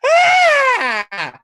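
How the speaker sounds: sudden high pained shriek; a shrill startled cry; leprechaun's yelp. Acted. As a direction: yelp in sharp pain as the fangs tear free. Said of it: "And he's like".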